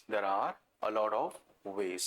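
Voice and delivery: low and slow, pausing before "ways"; "of" lifted, then "ways" set apart; slow, broken-up read-out. Shown as a demonstration. Teacher has the class hunt for mistakes, slow broken-up read-out. Teacher repeats sentence in pieces for checking.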